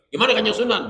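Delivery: high, loud, brash Gimana Kanjeng Sunan; student shouting disrespectfully at a saint; rude loud version. Shown as a demonstration. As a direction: blurt it at the saint rudely. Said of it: "Wah, wah, ngomongnya".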